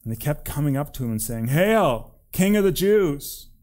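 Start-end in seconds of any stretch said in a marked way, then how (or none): 1.5-3.5 raised, louder mocking salute; drawn-out sham acclamation; mock hailing. A narrator's reading. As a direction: hail him like a jeering soldier. Soldiers mock the prisoner king.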